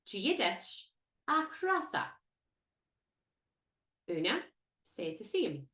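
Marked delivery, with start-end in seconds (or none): 0.1-2.1 slow, higher "Gia dich a Trasa" with a stressed "Trasa"; careful repeat. Shown as a demonstration. Teacher repeating a tricky name, slow and careful. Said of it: "That's a tricky one".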